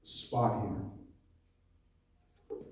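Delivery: ordinary talk, nothing marked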